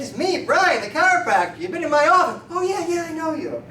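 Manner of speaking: high-pitched, insistent back-and-forth; acted animated exchange. Chiropractor reminds a forgetful patient, high animated exchange. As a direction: pitch it way up, reminder then sudden recognition.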